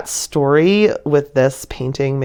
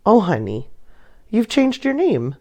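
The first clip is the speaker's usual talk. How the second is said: a hint of a mother teasing her muddy son, higher; lightly voiced mom, amused and pitched up; 10 semitones higher than usual. A narrator's reading. Mother bunny teasing her muddy little son, lightly voiced and amused.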